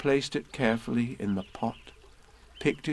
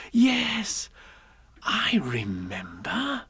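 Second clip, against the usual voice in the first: put-on child remembering; 9 semitones higher, much slower than usual. Christopher Robin voiced.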